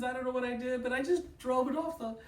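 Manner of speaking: high, wailing frantic explanation; hammed-up tearful babble; mock crying. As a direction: imitate a hysterical driver pleading. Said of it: "She is crying".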